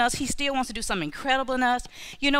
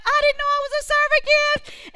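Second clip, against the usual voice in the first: high, loud tearful wonder; friend near tears; 5 dB louder than usual. Retold or imitated. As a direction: hand her friend's amazement back up high. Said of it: "She's like".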